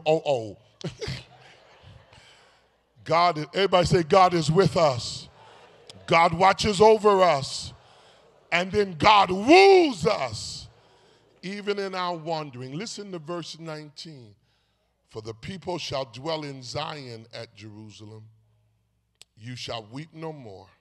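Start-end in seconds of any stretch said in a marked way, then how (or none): 3.0-10.7 preaching call. Acted. Preacher calling the congregation to repeat after him, louder. 15.1-20.7 scripture reading. Acted.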